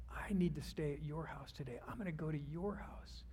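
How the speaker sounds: breathy whispered promise, close and intimate; Jesus quietly whispering to Zacchaeus in the tree; hushed whisper. Acted. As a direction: whisper it gently, like a private invitation. Jesus speaks softly to Zacchaeus.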